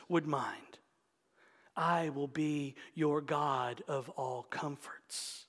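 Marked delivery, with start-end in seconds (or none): 1.8-5.4 soft, slow, tender reading of the promise. Shown as a demonstration. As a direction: savor the promise gently. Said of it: "I gotta read it again".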